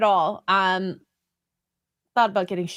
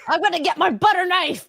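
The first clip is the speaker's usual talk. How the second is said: mock threat; 7 semitones higher than usual. Joking threat against bodyguards.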